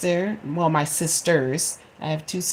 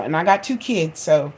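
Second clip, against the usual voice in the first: ordinary delivery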